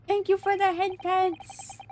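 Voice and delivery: high, slow sweet little voice; high cute pet voice. Acted. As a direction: coo like a grateful pet.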